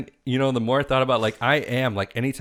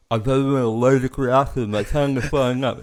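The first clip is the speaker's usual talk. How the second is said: higher and louder, faking alarm; mock panic; 4 semitones higher, 6 dB louder than usual. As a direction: sell the fake allergic reaction. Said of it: "I'm like".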